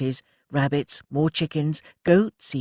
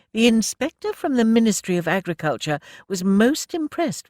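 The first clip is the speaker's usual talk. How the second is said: a higher, brighter-pitched voice for the factory lady; brisk lady manager; 8 semitones higher, 4 dB louder than usual. Acted. Mrs Frobisher telling Annie of the inspector, proper lady voice. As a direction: play the well-meaning welfare officer.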